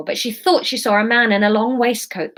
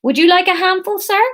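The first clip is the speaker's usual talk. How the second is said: put-on Somerset accent; 11 semitones higher, 5 dB louder than usual. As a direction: sing it out in a broad Somerset accent. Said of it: "this is gonna be Somerset".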